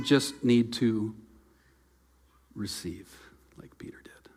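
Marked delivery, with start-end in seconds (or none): 2.5-4.3 a hushed whisper, barely voiced. Real, not acted. Pastor to congregation about receiving, in a whisper. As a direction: breathe it out like a secret. Pastor drops voice mid-sermon.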